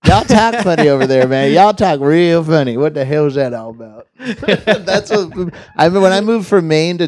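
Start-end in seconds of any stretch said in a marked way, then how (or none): 0.0-4.1 exaggerated Southern accent, amused; mock Southern accent. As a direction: play a puzzled Southern local. Puts on Southern redneck talk.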